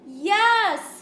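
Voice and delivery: loud, high group yell. A narrator's reading. All the animals yell at Cow, loud and high. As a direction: shout it all together. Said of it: "yelled all the animals together".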